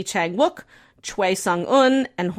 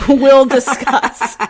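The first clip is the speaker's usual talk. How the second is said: slow, louder teasing "we'll discuss" with a laugh; laughing tease; 3 semitones higher, 8 dB louder, much slower than usual. Real, not acted. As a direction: hold back a laugh as you tease.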